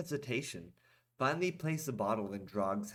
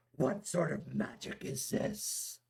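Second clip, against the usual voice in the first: surprised; 6 semitones higher, slower than usual. A narrator's reading. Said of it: "Drog asked in surprise".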